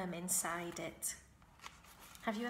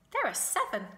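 goose voice; 7 semitones higher, 6 dB louder, faster than usual. A narrator's reading. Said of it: "said the goose".

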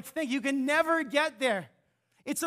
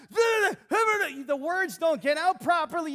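ordinary delivery

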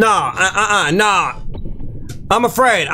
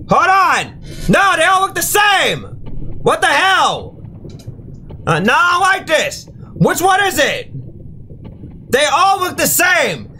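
frustrated shouting; 5 semitones higher than usual. Real, not acted. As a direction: yell at the screen in disbelief. Panicking, all look the same.

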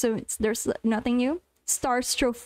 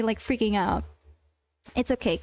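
ordinary delivery

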